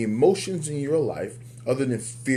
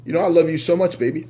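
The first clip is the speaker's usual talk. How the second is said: mock loving declaration; 5 semitones higher, 6 dB louder, much faster than usual. Husband telling wife he loves her.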